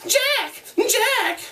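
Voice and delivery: mock him back bitterly; bitter mimicry. Jack mimicking Ralph's call, bitter and mocking. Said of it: "Jack's voice sounded in bitter mimicry".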